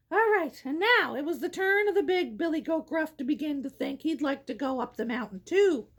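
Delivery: plain reading voice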